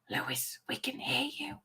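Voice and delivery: hushed, voiceless whisper. Acted. Reading Eric's chat message to Lewis, in a whisper. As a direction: whisper it as if in secret. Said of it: "in a whisper".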